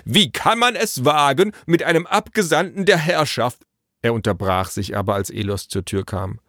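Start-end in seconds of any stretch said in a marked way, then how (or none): 0.1-3.6 high, affronted messenger voice; indignant outburst. A narrator's reading. Messenger fed up with Naru's cheek, high indignant protest. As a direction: protest with wounded official pomp. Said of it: "Nun hatte der Bote genug".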